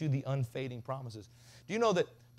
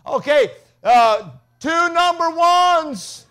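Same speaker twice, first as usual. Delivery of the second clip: mock shout, much higher and slow; yelling at the drive-thru; 16 dB louder, much slower than usual. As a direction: bellow the order at the drive-thru speaker. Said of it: "Dad's like".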